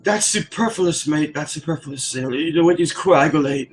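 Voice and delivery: high, hammed-up British big-word voice; mock English accent. Coworker showing off big words, mock English accent. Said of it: "he always uses big words too".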